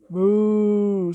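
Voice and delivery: drawn-out cow moo. Acted. Cow moos in story.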